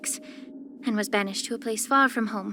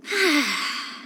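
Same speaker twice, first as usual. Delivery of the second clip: slow, deliberate exhale; long calming sigh; 3 semitones higher than usual. Shown as a demonstration. Healer showing how to manage emotions, long calming sigh.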